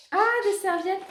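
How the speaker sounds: high delighted discovery; light surprised ah. Real, not acted. Surprised on unwrapping.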